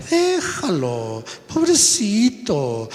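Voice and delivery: high, slow pitying grandma voice; doting grandparents. Retold or imitated. Preacher mocks doting grandparents, slow high pitying coo. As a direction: coo over the poor little boy, drawn out high. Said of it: "sale el abuelo y la abuela".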